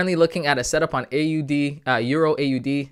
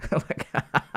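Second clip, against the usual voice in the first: breathy laugh; 2 semitones higher than usual. Real, not acted. Laughs at chart move.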